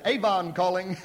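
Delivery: joking Avon lady. Retold or imitated. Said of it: "I thought I'd be funny".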